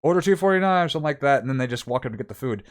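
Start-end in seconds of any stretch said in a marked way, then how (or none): 0.0-1.4 raised, higher callout; calling out order. Shown as a demonstration. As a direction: call the number across the counter. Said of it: "you're supposed to say".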